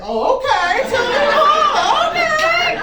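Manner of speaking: high, loud, drawn-out delight; mock-swooning listener hearing the accent; impressed listeners. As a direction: gush like you've just been charmed. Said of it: "they're like".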